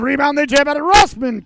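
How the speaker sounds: excited call. Real, not acted. Scramble at the net.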